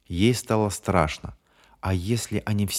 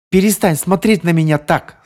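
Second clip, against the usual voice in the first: high, loud yell; 9 semitones higher, 8 dB louder than usual. Acted. Bonnie screams at James's stare, loud and high. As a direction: scream at him to stop. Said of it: "заорала она".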